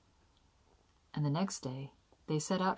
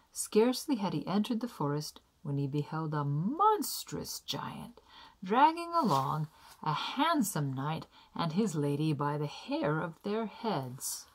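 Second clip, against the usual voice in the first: ordinary delivery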